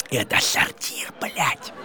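low unvoiced whisper; hushed confession about the latrine; whispered aside. Real, not acted. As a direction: let them in on a secret. Confiding aside.

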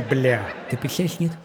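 ordinary talk

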